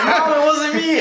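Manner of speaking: hammed-up innocent child through a laugh; pleading kid.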